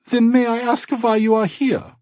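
put-on cheerful German accent. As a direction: welcome guests warmly, German accent. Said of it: "slight German accent".

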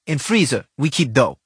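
put-on loud, blunt thick Russian accent. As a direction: bark it in a heavy Russian accent. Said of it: "she said in her thick Russian accent".

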